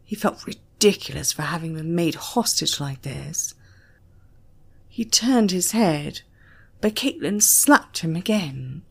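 usual speaking voice

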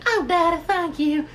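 high falsetto Texan daughter; squeaky put-on Southern girl voice; Ruth. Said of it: "she talks like that clearly 'cause she's also from Texas".

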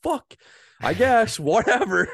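high, loud, laughing exasperation; hammed-up high grumbling with a laugh; exasperated mock resignation. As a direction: act out a fed-up give-up. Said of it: "I'm like".

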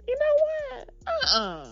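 acted fed-up outburst; sudden objection. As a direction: pitch it way up, then refuse. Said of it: "it's like".

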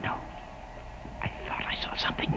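whispered, wary; frightened whisper. Acted. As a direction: barely voice it, something is watching. Whispering of unseen danger.

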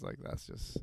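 high and slowed down, half-muttering; stoner. Real, not acted. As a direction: talk like you've just had a strong gummy. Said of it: "sorry, I'm really high right now".

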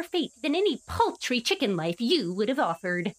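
high, grand sneering villain voice; sneering panto villain. Acted. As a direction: lord it over them like a pompous villain. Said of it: "hearing [name] reading this note like that".

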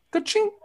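high rising ka-ching; cash register ka-ching. Pretend. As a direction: chirp ka-ching like a till. Arcade coin sound.

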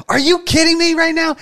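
exasperated wife. Retold or imitated. Husband imitating his pregnant wife's annoyance at the car prank, mock-exasperated. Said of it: "And then she's like".

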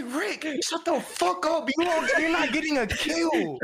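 high-pitched, exasperated telling-off; retold angry outburst. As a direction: re-enact losing it at a teammate. Retelling angry outburst at teammate.

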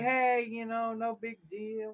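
high-pitched, breezy smoothing-over; acting out a nervous peacemaker; retold placating. Retells himself placating an angry biker.

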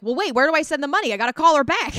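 high, loud and fast; hurried flustered old lady; frantic grandma. Retold or imitated. Grandma rushing to send scam money, flustered and fast.

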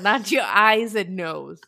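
slow, loud jibe breaking into laughter; laughing jibe. Real, not acted. Co-host joking about a mask worn wrong, laughing. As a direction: draw it out loudly through a laugh.

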